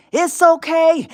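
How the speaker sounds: ordinary talk, nothing marked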